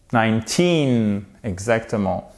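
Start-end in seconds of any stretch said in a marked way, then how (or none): 0.1-1.2 put-on slow "Nineteen", long falling TEEN; stress on TEEN. Shown as a demonstration. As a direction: draw out the end of "Nineteen". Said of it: "m'aider à prononcer dix-neuf". (accent long sur la fin de « Nineteen »)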